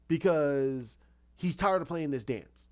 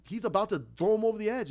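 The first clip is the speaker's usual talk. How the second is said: plain reading voice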